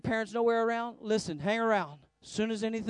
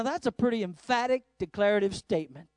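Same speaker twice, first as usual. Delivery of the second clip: ordinary delivery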